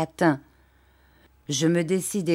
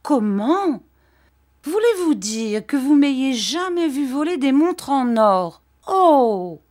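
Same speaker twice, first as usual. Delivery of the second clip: put-on much higher, louder offended young wife; indignant Dora; 7 semitones higher, 5 dB louder than usual. Dora answering her husband's accusation, higher and louder. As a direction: throw the question back at him, hurt.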